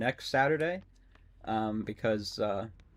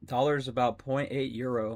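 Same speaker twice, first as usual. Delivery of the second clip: ordinary talk, nothing marked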